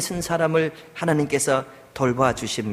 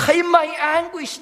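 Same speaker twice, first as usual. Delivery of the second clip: anguished cry; 4 dB louder, much slower than usual. Acted. Preacher voicing the psalmist's anguish.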